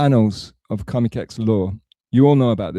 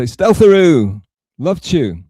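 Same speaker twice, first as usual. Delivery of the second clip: high, louder cheery greeting; imitating Taz; 5 semitones higher, 6 dB louder, slower than usual. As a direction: call it out bright and high like a sweet host. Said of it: "she'd say".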